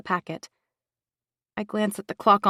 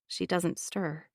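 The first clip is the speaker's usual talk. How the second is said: ordinary delivery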